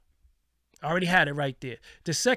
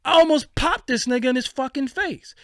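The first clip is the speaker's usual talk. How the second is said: loud, higher, worked-up telling; heated; 8 semitones higher, 6 dB louder than usual. Real, not acted. Reliving the moment he nearly hit the man, loud and heated. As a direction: let the anger flare again. Still fuming retelling.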